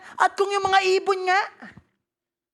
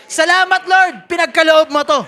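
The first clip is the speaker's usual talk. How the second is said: loud thankful prayer; 7 dB louder than usual. Acted. Preacher voicing a believer thanking God, loud. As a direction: call out your thanks to the Lord.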